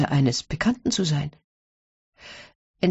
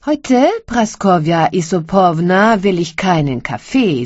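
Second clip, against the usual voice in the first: louder, lightly voiced barber demanding; 3 semitones higher, 6 dB louder than usual. A narrator's reading. Barber telling his wife he wants no coffee, lightly voiced. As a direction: barber announcing his wish at breakfast.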